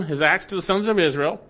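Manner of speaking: normal talking voice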